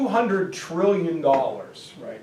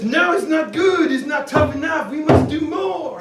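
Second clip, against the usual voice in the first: mock demand; 7 semitones higher than usual. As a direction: push it like an indignant official. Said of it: "And France says".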